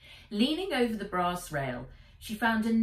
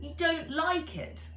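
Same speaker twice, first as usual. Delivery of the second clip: higher, hurt little question; 10 semitones higher than usual. Acted. Robot dragon asking if Nat dislikes his voice, hurt. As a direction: ask as if a bit wounded.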